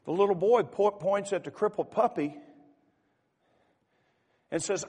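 loud, high, impassioned story telling from the pulpit; fervent sermon storytelling. Acted. Preacher to congregation, telling the puppy story with loud fervor. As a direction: preach the story out with rising force.

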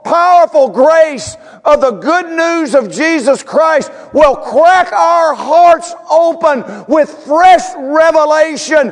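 high, slow, drawn-out preacher's cry; full-force preaching. Real, not acted. Pastor prays grace cracks hearts open, fervent high preaching. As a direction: stretch each phrase high, punching the key words.

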